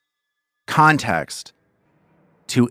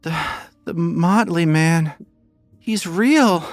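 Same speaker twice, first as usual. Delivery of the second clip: put-on high, slow, louder pleading child; frightened girl; 12 semitones higher, 4 dB louder, slower than usual. Frightened girl insists to the party, high and pleading. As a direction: insist desperately that he is real. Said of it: "she looks at you and she says".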